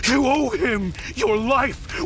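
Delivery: high, slow, pained disbelief; why would you betray him, cried out high and slow; anguished accusation. Acted. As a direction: plead through betrayal, voice climbing. Confronts partner who sold weapons.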